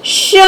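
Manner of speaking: श with the vowel hummed through the nose; nasalized vowel. Shown as a demonstration. Teacher showing children chandrabindu, nasalized श. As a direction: draw out श as a nasal शँ. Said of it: "श पर लगाएंगे तो".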